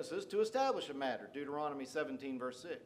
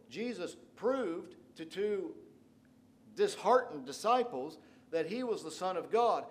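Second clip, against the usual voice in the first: ordinary talk, nothing marked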